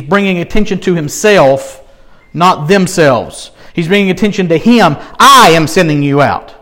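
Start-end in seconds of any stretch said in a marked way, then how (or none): 5.2-6.5 "I" louder, "you" light; big "I", little "you"; stress on I. Shown as a demonstration. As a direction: lean on "I am" then drop off. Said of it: "Big I, little U".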